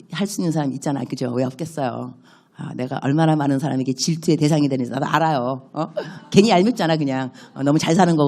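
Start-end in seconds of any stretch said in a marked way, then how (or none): none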